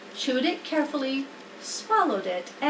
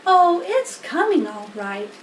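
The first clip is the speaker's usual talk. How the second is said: lightly; 7 semitones higher, 7 dB louder than usual. A narrator's reading. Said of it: "she said lightly".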